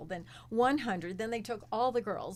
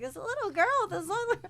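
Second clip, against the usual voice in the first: put-on high squeaky little girl; 11 semitones higher, 4 dB louder than usual. Shown as a demonstration. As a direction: squeak it like a small child. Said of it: "squeaky little voice".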